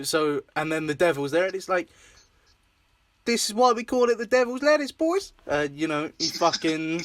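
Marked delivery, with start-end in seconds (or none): 3.3-5.3 high, quick mock Aussie voice; put-on Aussie accent. Quoting the show's devil scene in a high mock Aussie accent. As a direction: do a hammed-up Australian accent, pitched up. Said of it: "Aussie accents".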